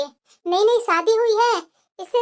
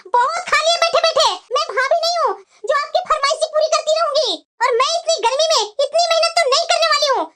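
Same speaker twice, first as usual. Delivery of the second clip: raised pitch, sharp scolding at husband; scolding wife; 6 semitones higher than usual. Acted. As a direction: tell him off firmly, voice pitched up. Wife scolds husband over demands.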